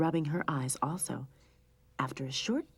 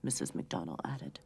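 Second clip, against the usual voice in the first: usual speaking voice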